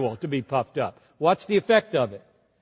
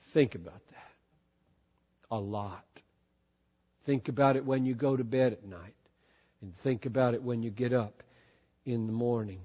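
much softer and lower, urgent; soft, grave plea to the congregation; hushed appeal; 8 semitones lower, 10 dB quieter, faster than usual. Acted. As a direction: drop to a near hush so they lean in. Preacher drops to intimate appeal.

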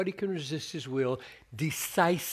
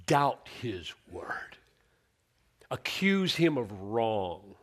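slow emphatic; 3 semitones lower, slower than usual. Shown as a demonstration.

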